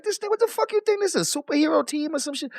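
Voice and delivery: high, scoffing disbelief at a teammate; incredulous mockery. Real, not acted. Streamer scoffing at Biz joining Bus Life, high incredulous tone. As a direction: sneer at the superhero-team idea. Mocking Biz teaming up with rivals.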